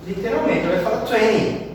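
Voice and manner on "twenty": twenty said twenny, the T swallowed; American twenty. Shown as a demonstration. Teacher contrasting American and British, T swallowed. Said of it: "Parece que ele vai comer esse T aqui".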